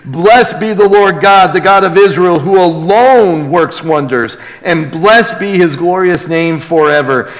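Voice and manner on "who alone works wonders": ordinary talk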